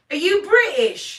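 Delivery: high, loud imitation of a passenger; excited passenger. Driver retelling her passenger's question, loud imitation. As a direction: blurt it out loudly as the curious rider. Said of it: "he looks at me and he goes".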